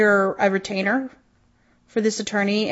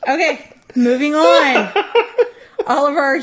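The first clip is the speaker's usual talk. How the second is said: laughing; 5 semitones higher, 4 dB louder than usual. Real, not acted. Laughing, trying to move on.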